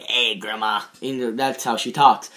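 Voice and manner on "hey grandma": lightly voiced possessed boy, lower and softer; a hint of the aunt's voice in a low pitch; possessed boy's voice. Fan mimicking how the possessed boy greets grandma, lightly put-on low voice. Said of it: "has her voice".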